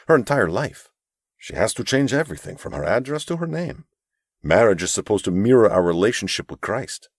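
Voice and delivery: lightly voiced gentle older mentor; a hint of a kind, pleading elder; earnest mentor. Narrator voices Abram.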